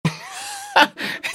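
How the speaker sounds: high squealing laugh; shrieking laugh. Real, not acted. Cracking up at the asylum party joke, squealing laugh.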